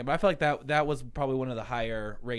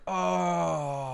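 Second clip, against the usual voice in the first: imitated crowd "oh", drawn out and higher; crowd reaction; 4 semitones higher than usual. Retelling the crowd's response, mock collective "oh". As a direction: do a whole crowd's long reaction. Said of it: "And everyone's like".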